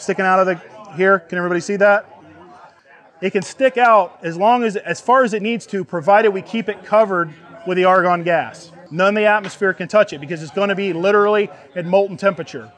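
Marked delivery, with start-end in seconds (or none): none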